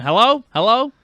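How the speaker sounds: high, loud, rising calls; acted phone hello. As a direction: call down a dead phone line. Acting out a cut-off phone call.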